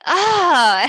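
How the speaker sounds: a loud mock "ah" of disgust; retold groan of disgust. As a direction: groan it like stepping in something. Husband finds dog poop.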